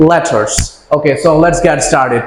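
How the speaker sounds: ordinary talk, nothing marked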